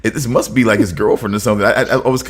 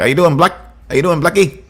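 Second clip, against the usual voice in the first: Australian accent; 5 semitones higher than usual. Shown as a demonstration. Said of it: "you gotta do it in an Australian accent".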